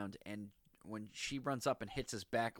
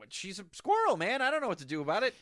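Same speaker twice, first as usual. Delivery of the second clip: higher, louder imitation of a flustered boy; exasperated protest; 9 semitones higher, 6 dB louder, faster than usual. Podcaster voicing flustered Wart to a co-host, exasperated protest. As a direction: plead loudly that you're at a loss. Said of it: "he's just like".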